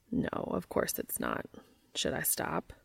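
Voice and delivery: very low, soft character voice; Cass's low voice. A narrator's reading. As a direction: drop deep and quiet. Narrator voices Cass, low.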